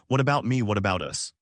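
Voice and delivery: ordinary delivery, nothing marked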